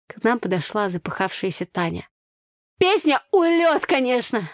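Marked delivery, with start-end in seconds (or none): 2.8-4.5 loudly. A narrator's reading. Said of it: "громко проговорила она".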